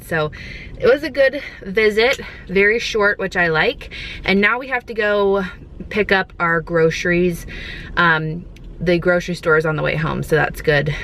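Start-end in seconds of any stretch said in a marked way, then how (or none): none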